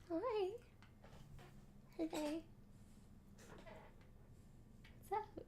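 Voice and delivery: high, soft pet voice. Real, not acted. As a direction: coo at the puppy. Greeting the dog in pet voice.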